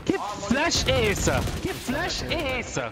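slow, stretched outraged question. Real, not acted. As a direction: drag the words out in disbelief. Blinded by flash, complaining. (indignado e lento)

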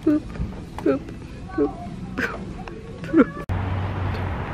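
high soft unvoiced boops; playful boops. Real, not acted. Booping cute items in Target, soft high boops. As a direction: boop it cutely, tiny and high.

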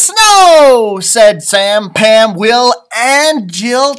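loud, high cry of snow; joyful shout. Acted. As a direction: shout it like the best news ever. Big snow announcement.

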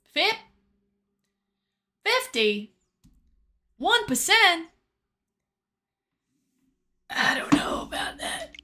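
ordinary talk

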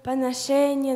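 ordinary delivery

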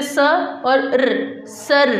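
स and र sounded apart slowly, then blended into सर; sounding out, blending. Shown as a demonstration. Teacher blends seen with re for children, sounding out सर. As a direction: sound out स, then a vowelless र, then join as सर. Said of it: "सीन की आवाज़ के साथ हम रे की आवाज़ को मिलाकर".

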